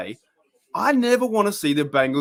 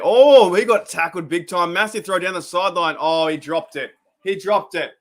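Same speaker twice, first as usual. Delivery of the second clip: 1 excited reaction; 5 semitones higher, 7 dB louder than usual. Real, not acted. Reacting live to a big play.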